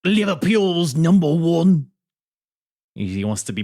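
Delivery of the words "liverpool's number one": high, loud, slow Liverpool boast; amateur Scouse wrestling-heel shout; Scouse accent. Acted. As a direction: crow it in hammed-up Scouse. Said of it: "I'm trying to become a Scouse here".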